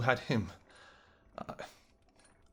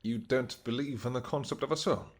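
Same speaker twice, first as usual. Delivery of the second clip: ordinary talk, nothing marked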